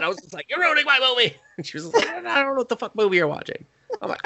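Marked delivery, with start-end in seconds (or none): 0.5-1.3 high-pitched whiny accusation; hammed-up crabby complaint to a wife; mock cranky old man. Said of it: "I went from sad to cranky old man". 2.1-3.6 fast, high-pitched protest; retold flustered comeback; mock defensive reply. Acts wife's defensive reply.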